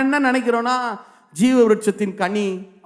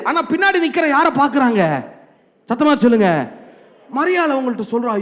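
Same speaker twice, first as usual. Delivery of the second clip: ordinary talk, nothing marked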